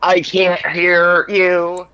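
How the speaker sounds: slow, loud call-out. Real, not acted. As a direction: speak up slowly and loudly. Testing if the call works.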